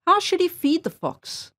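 usual speaking voice

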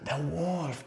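soft, stretched "wolf"; secretive picture-book menace; hushed storyteller suspense. Shown as a demonstration. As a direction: softly warn that the wolf is coming. Demonstrates the wolf's appearance to children.

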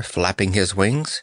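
plain reading voice

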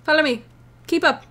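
ordinary talk

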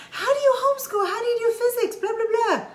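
high, mocking questioner with a laugh. Mom mocking people who doubt homeschooling, high mock voice. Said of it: "people have said".

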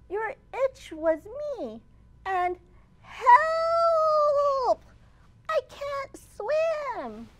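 high squeaky mouse crying for help; a tiny mouse falling into water; panicked mouse. Acted. Mouse dumped in the lake, high panicked cry.

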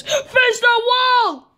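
high, slow sobbing yell; crying shout. Acted. Kid-as-parent orders friend to the wall, crying shout. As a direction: shout it through tears.

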